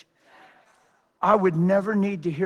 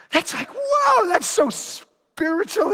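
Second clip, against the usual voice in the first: awed whoa; 12 semitones higher, slower than usual. Real, not acted. Preacher marvels at the verse.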